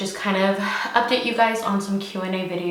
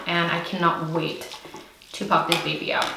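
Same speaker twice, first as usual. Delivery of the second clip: usual speaking voice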